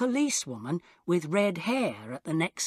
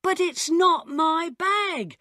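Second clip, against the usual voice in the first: much higher, slow, louder protest; panicked traveller shouting at the policeman; shouted denial; 11 semitones higher, 3 dB louder, slower than usual. A narrator's reading. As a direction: cry out your innocence. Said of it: "I shouted".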